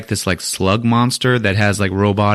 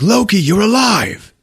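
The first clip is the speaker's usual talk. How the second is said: surprised joy; 11 semitones higher than usual. Acted. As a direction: blurt it out amazed to see a brother alive. Thor surprised to see Loki.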